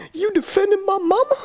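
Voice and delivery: high disbelieving squeak; incredulous. Retold or imitated. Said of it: "And I went".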